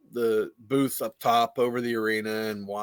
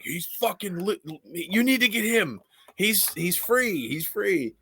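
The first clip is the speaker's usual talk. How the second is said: higher, slow, eager mock whisper-pitch; urging the Stooge; 8 semitones higher than usual. Imitating the Stooge urging Quinn to sign him. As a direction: egg him on, he's free.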